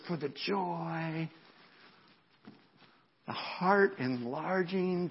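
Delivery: slow, weighted phrases with long pauses; solemn scripture reading. Acted. Preacher reading Hebrews to the congregation, slow and solemn. Reads the verse slowly and solemnly.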